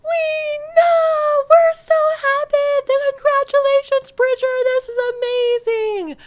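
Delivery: very high, loud, gushing joy; elated angels. Retold or imitated. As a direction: shriek with delight as a cheering crowd. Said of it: "And they're like".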